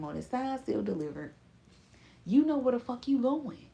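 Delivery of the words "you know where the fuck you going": emphatic call-out. Real, not acted. Calling out praise to viewer.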